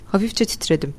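plain reading voice

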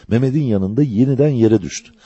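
ordinary delivery, nothing marked

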